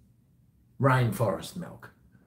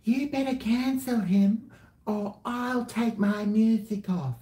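high, mocking whine of an indignant fan; sneering high-pitched imitation of outraged people; mocking whiny demand; 12 semitones higher than usual. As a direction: mimic a petulant fan threatening to pull their music. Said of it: "there's more people saying".

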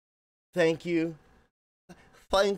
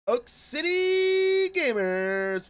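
high, loud, drawn-out greeting; hyped-up shout; 13 semitones higher, 6 dB louder, much slower than usual. Pretend. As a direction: stretch the name out like a hype man.

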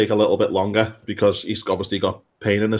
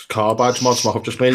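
ordinary delivery

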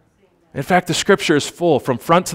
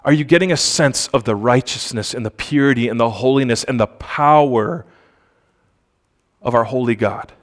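ordinary delivery

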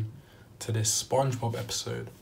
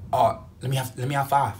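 pushy demand; 2 semitones higher, 7 dB louder than usual. Retold or imitated. Crackhead demanding money.

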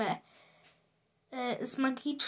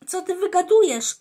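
high, louder outraged retort; indignant; 5 semitones higher, 6 dB louder than usual. A narrator's reading. Rolex outraged at his friend's scheme, indignant outburst. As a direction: bristle at the idea, higher and louder. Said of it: "oburzył się Rolex".

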